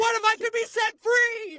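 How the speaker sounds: put-on lifted, high and wistful, as the kid; dreamy high voice. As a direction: voice the kid's yearning in a high, faraway tone. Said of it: "He's like".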